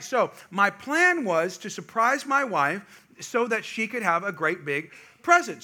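normal talking voice

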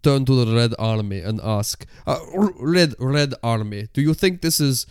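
put-on Russian accent, breaking into giggles; comic Russian with stray articles. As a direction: ask in a halting mock Russian accent. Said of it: "the accent".

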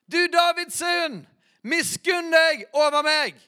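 high, loud and slow, full-throated cry. Retold or imitated. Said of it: "står det enda høyere".

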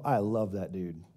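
low, soft, contented guy voice; satisfied guy. Retold or imitated. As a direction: mumble it like a happy buddy after a long drive. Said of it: "and go".

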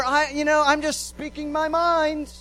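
defensive excuse. Retold or imitated. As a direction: brush it off as just honesty.

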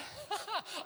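high giggle trailing off; high laugh. Real, not acted. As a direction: let a quick laugh out. Laughter after the story.

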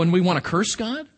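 usual speaking voice

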